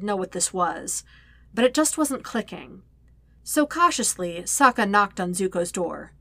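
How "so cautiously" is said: ordinary delivery, nothing marked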